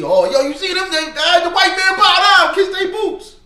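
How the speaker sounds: high, loud, hyped-up retelling; excited fan impression. Preacher imitates street fans amazed by the Israelites, excited.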